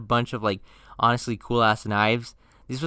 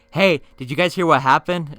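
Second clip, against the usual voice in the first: shouting; 4 semitones higher, 7 dB louder than usual. Retold or imitated. Kids screaming news at school.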